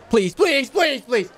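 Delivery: high, whiny pleading voice; mock begging. Haggling player begs the merchant in a high whiny mock plea. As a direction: beg the merchant like a pitiful child.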